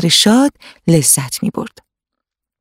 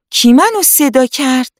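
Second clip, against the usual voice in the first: put-on a higher, startled little girl's question; surprised girl; 7 semitones higher than usual. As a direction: jump at an unseen voice. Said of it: "با تعجب چشاشو باز کرد و پرسید". (دختربچهٔ غافلگیر، با صدای بالاتر)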